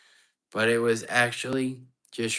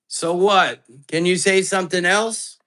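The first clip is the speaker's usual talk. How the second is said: loud, high call into the empty room; raised voice challenging the spirit; 8 semitones higher, 10 dB louder than usual. Real, not acted. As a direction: shout out to the ghost to answer. Calling out to a ghost.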